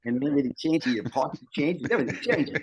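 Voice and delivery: flat Australian accent put on for fun; Aussie accent. Shown as a demonstration. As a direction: slip into a playful Aussie twang. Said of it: "talk with that flat Aussie accent".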